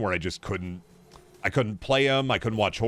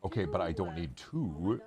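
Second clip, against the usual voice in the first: hushed aside; 4 semitones lower, 10 dB quieter than usual. Real, not acted. Talking quietly to wife off mic.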